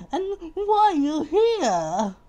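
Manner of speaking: very high, slow questioning; fussy indignation. Acted. Mister Fussy questions the stranger, high indignant voice. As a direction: stretch each word, high and put out.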